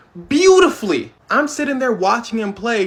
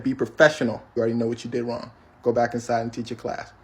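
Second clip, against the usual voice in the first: quiet stern rebuke; 8 semitones lower, 5 dB quieter than usual. Retold or imitated. Scolding the teacher.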